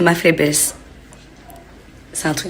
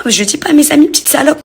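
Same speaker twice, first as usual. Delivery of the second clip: indignant retort; 9 semitones higher, 5 dB louder, faster than usual. Retold or imitated.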